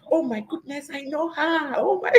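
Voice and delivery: high-pitched imagined fan squeal, held back in volume; mock excited shouting. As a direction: act out shouting in recognition, breaking into a laugh. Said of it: "I'm going to be shouting".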